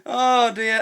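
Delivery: ordinary talk, nothing marked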